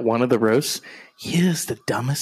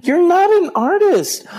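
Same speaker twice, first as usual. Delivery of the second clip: scornful outburst; 6 dB louder than usual. Real, not acted. Yelling at TV speech.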